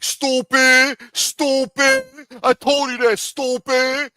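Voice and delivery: hammed-up Bronx kid taunt; Dominican kid impression. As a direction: squeal it like a cocky teen teasing a friend. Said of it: "Some stupid Dominican kid like".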